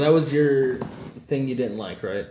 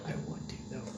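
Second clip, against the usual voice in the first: unvoiced sneaky whisper; mock whisper of a guilty urge; whispered temptation; 17 dB quieter, faster than usual. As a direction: whisper the secret wish. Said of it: "You're just like".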